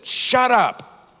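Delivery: high, sharp shouted "shut up"; retold shout. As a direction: bark it like an angry coworker. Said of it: "he just shouted loudly".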